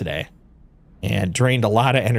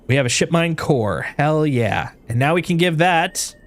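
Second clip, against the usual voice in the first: normal talking voice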